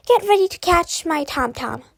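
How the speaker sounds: bright, loud calling voice; called out. A narrator's reading. Mother calling to her son before throwing the teacher. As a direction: shout it across to him.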